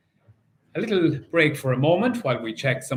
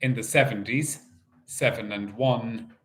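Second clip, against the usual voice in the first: bingo caller; 4 semitones higher than usual. Acted. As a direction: call the numbers like a bingo hall host. Calling bingo numbers in rhythm.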